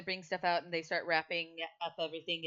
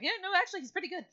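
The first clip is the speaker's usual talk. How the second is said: a much higher, louder creature voice; squeaky character voice; 5 dB louder, much faster than usual. Acted. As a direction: pitch way up for a small critter's approval. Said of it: "he's like".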